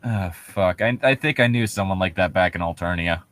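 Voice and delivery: ordinary talk, nothing marked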